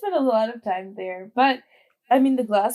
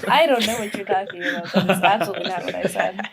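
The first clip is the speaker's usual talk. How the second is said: mock denial. Mock denial about drugs.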